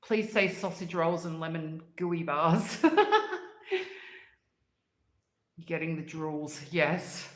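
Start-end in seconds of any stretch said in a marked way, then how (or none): 3.0-4.2 high-pitched burst of laughter. Real, not acted. Laughing at drooling.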